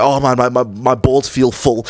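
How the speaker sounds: mock a guy groaning about his needs; loud, slowed mock complaint; mocking whiny guy. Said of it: "Imagine there's a guy who's like".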